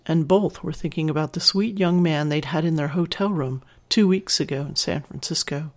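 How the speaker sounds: plain reading voice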